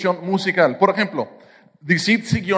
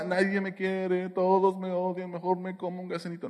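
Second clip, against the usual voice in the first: soft, whiny sulking sing-song; a half-sung pout, much softer; mock self-pity; 11 dB quieter than usual. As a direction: pretend to mope like a sad kid. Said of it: "no está cantando".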